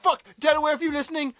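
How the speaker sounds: playful angry rant; mock yell at Delaware. Real, not acted. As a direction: shout it at the whole state. Addressing Delaware directly.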